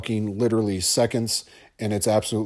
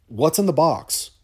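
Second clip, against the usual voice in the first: raised, strained quote of "What's in the box?" as a first try; movie quote impression; 4 semitones higher than usual. Shown as a demonstration. Host quotes Seven to listeners, rising anguish. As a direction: start the line from Seven a bit higher, holding back. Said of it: "Had to do it, sorry".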